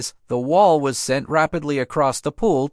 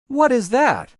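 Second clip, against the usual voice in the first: bellowing; 8 semitones higher than usual. A narrator's reading. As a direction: bellow it out in shock. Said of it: "he bellowed".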